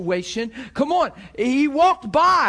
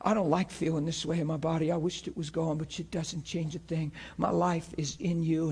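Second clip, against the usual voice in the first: softer and lower, an honest prayer; quiet prayer; 7 semitones lower, 9 dB quieter than usual. Acted. A believer praying to God each morning, quiet and low. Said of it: "getting up every day and saying".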